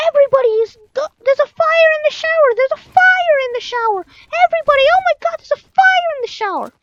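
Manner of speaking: loud high panicked scream. Retold or imitated. Boy screaming to the whole camp about a fire, loud high scream. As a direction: scream the alarm as loud as you can. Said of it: "he started screaming".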